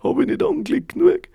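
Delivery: sehr hoch und laut hinausgeschrien; verzweifelter Schrei. Acted. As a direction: schrei deinen ganzen Kummer heraus. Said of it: "hat er geschrien". (sehr hoher, lauter Verzweiflungsschrei)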